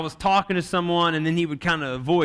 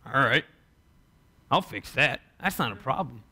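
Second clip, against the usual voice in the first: calm reassurance; 7 semitones lower, 4 dB quieter than usual. Retold or imitated. Jesus voiced as calm fixer.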